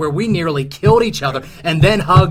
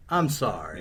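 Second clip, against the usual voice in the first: sarcastic, lower and softer I'm sorry; insincere apology; 8 semitones lower, 9 dB quieter than usual. Acted. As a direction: apologise without meaning it. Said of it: "to hear me say I'm sorry".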